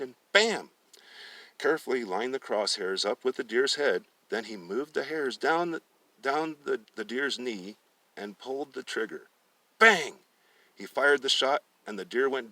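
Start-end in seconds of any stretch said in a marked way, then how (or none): 0.0-0.7 loud, high sharp bam; bam gunshot. Acted. Narrator imitating the rifle shot, loud. 9.8-10.1 loud high bang; gunshot bang. Acted. Narrator voicing the rifle shot, loud and high.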